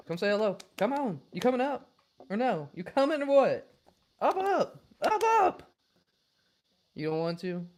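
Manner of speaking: climbing high squeaky coaxing; rising pet-talk voice to coax the dog up. Real, not acted. Coaxing dog onto lap.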